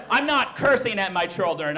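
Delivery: usual speaking voice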